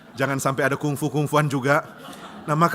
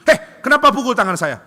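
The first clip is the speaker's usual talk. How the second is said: loud high protest; boy yelling after the teacher slaps his hand; outraged yelp; 10 semitones higher, 11 dB louder than usual. Acted. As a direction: shout at being hit. Child hit on hand.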